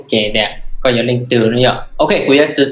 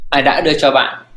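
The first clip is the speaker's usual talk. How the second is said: ordinary talk, nothing marked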